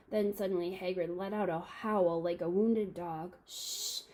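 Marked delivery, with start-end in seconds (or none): 3.5-4.0 sharp unvoiced shush; urgent hissing shush; hissed shush. A narrator's reading. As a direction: hush them sharply, breath only. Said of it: "hissed Professor McGonagall".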